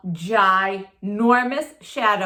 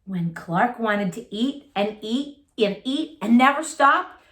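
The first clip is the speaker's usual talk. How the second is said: ordinary delivery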